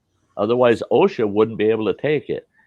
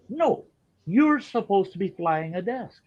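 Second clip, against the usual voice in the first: much higher, mimicking a fussy commission member; higher mock official ordering shutdown; 9 semitones higher, 3 dB quieter than usual. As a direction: squeak out the orders like a pompous bureaucrat.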